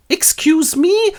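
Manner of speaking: high, slow, stunned excuse me; a squeaky, affronted protest; indignant surprise. Acted. Xuri objecting to being sold, high and stunned.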